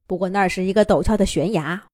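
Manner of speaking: plain reading voice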